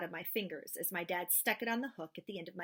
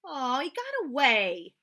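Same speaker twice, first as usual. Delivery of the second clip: disappointed; 5 semitones higher, 9 dB louder, slower than usual. Acted. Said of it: "I said, disappointed".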